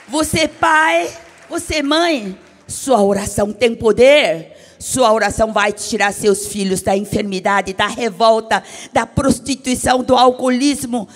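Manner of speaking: loud, forceful pulpit voice; preacher pounding each item; fiery preaching. Acted. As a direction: thunder the promise at the parents. Preaching to parents.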